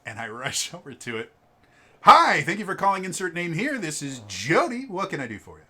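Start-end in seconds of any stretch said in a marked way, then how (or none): 2.0-5.7 higher, louder customer-service phone voice; phone greeting. Retold or imitated. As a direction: answer the phone like a chipper clerk. Clerk answers store phone.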